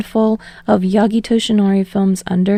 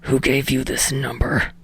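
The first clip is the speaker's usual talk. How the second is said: lower, softer growling Bakugo voice; snarled; 6 semitones lower, 5 dB quieter than usual. Acted. Bakugo answering Deku's call, low snarl. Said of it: "and snarls".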